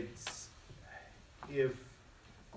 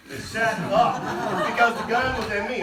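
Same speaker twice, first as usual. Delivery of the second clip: usual speaking voice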